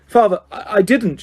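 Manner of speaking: stammering panic; boy in chains crying out to his father; shrieked. A narrator's reading. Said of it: "shrieked the boy".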